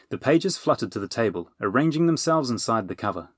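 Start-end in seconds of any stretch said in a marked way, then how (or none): none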